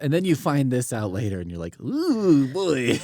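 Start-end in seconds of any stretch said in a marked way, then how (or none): 1.8-3.0 put-on high, loud dismayed 'Ooh, boy'; mock dismay. Gang member realizing his mistake, in mock high dismay. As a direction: voice the gang's sheepish shock, up high.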